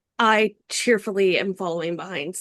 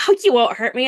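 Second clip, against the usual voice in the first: cheerful reassurance; 4 semitones higher, 6 dB louder, faster than usual. Acted. Gnome reassures the orc.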